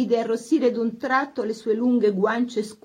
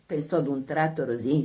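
ordinary delivery

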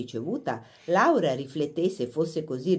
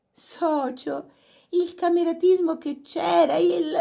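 delighted laugh; 13 semitones higher, 4 dB louder, slower than usual. A narrator's reading.

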